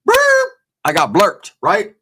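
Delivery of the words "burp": short high-pitched police siren whoop; siren blip. Retold or imitated. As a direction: imitate a cruiser's loud blip.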